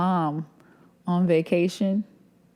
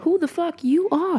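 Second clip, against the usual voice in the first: indignant mock; 10 semitones higher than usual. Mocking the jealous side chicks.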